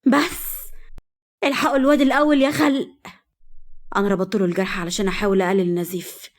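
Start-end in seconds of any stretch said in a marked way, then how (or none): 0.1-2.9 higher urgent call for help; urgent plea. Acted. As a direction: cut them off and call everyone to help. Daughter cuts off the quarrel urgently.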